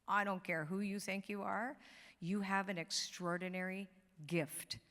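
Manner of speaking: ordinary talk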